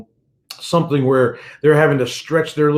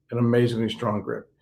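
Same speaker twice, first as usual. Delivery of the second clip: amazingly strong grip repeated much softer and lower; soft echo; 3 semitones lower, 6 dB quieter than usual. Real, not acted. Pastor echoing his point to listeners, soft falling echo. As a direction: let amazingly fall away, almost to yourself.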